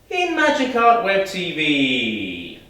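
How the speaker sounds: loud, high, jubilant cheer; big drawn-out happy shout; joy and happiness. Shown as a demonstration. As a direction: proclaim it joyfully, stretched out. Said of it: "said with a sense of joy and happiness".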